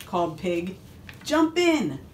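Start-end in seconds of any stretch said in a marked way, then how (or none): 1.2-2.0 high-pitched storybook invitation; high, eager call; eager pig calling. A narrator's reading. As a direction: call a friend into the water. Pig calls cow into pond.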